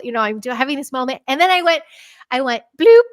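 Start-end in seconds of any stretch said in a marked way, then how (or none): 2.8-3.0 loud, quick bloop; a sudden cartoonish sound effect; imitated bloop. Imitates own sudden ducking move.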